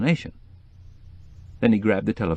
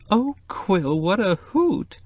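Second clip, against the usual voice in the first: much higher, delighted squeal; shrieking with glee; 13 semitones higher, much slower than usual. A narrator's reading. Cokie shrieks with glee at the brothel news, high and delighted. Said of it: "Cokie shrieked".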